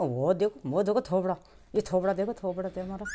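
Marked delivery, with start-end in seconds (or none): none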